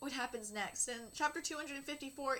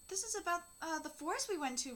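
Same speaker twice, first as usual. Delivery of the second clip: ordinary talk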